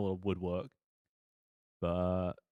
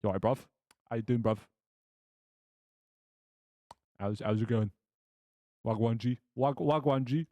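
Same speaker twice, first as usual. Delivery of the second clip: a light British chav voice; mock chav accent. Player trying greetings for a chav blacksmith, mock chav. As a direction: try out chav street greetings. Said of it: "Chav. The Chav blacksmith".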